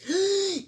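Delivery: sharp gasp, caught by emotion; a startled tearful intake of breath. Retold or imitated. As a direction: gasp as if overwhelmed. Overwhelmed mid-tears.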